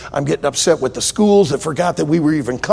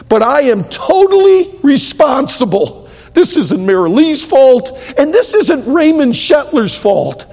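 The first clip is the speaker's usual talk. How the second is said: resolute prayer; 6 semitones higher, 3 dB louder than usual. Retold or imitated. His prayer quoted, resolute.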